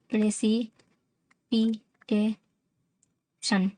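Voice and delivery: "Precipitation" very slowly in five separate beats; "pray", "सी", "पी", "टे", "शन", pausing after each; syllable by syllable. Shown as a demonstration. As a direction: sound out "precipitation" for learners. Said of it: "पांच syllable हो रहा है".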